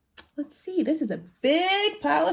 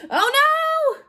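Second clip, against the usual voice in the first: mock alarm; 12 semitones higher, 4 dB louder than usual. Reading to kids, mock alarm.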